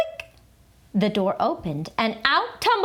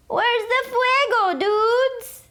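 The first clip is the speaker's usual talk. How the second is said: high little chihuahua; 13 semitones higher, slower than usual. A narrator's reading. Poquito Tito asking the friends, high squeaky. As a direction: squeak it out eagerly.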